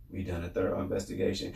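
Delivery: low, quiet mock official; mocking imitation. Host mimicking officials' excuse, mocking. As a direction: mimic their excuse, dropped low and soft.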